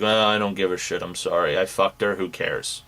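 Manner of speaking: low, careless mumbled imitation; imitating a guy who doesn't care; mock indifference. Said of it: "he just outright was like".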